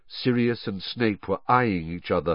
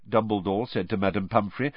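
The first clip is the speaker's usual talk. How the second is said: ordinary delivery, nothing marked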